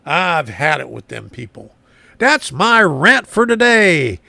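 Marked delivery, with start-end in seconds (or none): 2.2-4.2 loud, high, drawn-out proclamation; mock announcement. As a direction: proclaim it slowly, playfully big. Mock announcement closing his rant.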